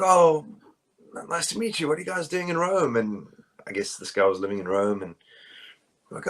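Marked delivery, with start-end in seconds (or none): 0.0-3.0 raised pitch, friendly stranger greeting tourists; a hint of the eager local meeting the band; lightly voiced Italian shop guy. Shop guy in Rome greets the American band, high and friendly.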